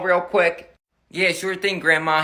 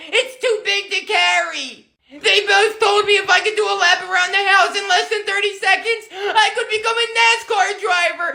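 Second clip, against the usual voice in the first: whiny little Billy; 9 semitones higher than usual. Acted. Little Billy lying to his mother, high whiny kid voice. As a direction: protest like a fibbing little boy.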